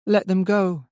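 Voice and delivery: put-on high child's voice with little expression; expressionless, dull child. A narrator's reading. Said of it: "expressionless and dull".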